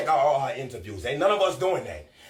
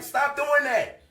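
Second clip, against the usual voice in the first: shouted scold; 10 semitones higher, much slower than usual. Real, not acted. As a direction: yell it as a command.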